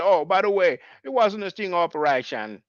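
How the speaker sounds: amateur attempt at a Jamaican accent. Host quoting someone on the sting operation, imitated Jamaican accent. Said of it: "he's making fun of Jamaican people".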